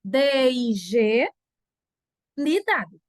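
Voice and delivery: "D I G" letter by letter, slowly; spelling out. Shown as a demonstration. Teacher spelling dignidade for the class, slow letters. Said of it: "Se escreve assim".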